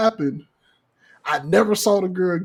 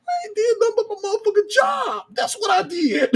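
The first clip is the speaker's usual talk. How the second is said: high, fast, hammed-up protest; mock defensive. [name] answering his friends, quick hammed-up protest. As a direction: blurt out the excuse. Defending himself in retold banter.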